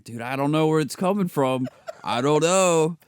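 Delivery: mock-innocent protest. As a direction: raise your voice, playing clueless about it. Coworker feigns ignorance in retold prank.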